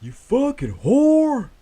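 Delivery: high, loud, slow drawn-out yell; deep country holler. Retold or imitated. Showing a friend how the neighbor sounded, imitated country holler. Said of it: "this is how he sounded".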